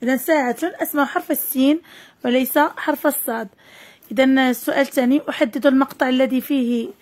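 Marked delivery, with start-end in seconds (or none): none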